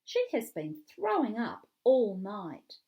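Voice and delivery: ordinary delivery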